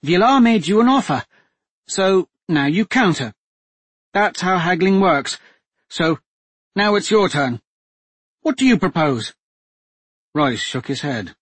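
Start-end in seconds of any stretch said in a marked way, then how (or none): 0.0-9.3 higher, louder, composed noblewoman; a hostage duchess coolly taking charge; steady duchess voice. A narrator's reading. Captive duchess telling the men to make a deal, steady and firm.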